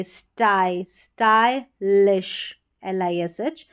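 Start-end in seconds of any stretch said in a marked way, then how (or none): 0.0-2.6 स्टाइलिश slowly split as "stai, lish"; syllable-by-syllable demo. Shown as a demonstration. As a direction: say स्टाई alone, then add "lish" for स्टाइलिश. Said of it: "आई करके pronounce करेंगे".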